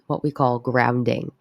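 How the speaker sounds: ordinary delivery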